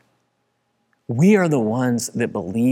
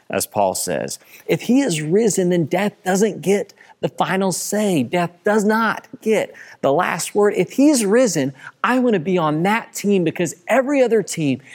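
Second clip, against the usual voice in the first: worked up preaching; 5 semitones higher than usual. Real, not acted. Pastor rallying his congregation about the risen Jesus, fervent full-force preaching. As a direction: build the fire like a pastor on Easter. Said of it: "I'm getting worked up here".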